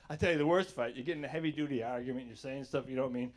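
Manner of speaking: ordinary talk, nothing marked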